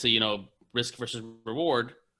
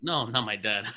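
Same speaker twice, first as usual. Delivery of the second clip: usual speaking voice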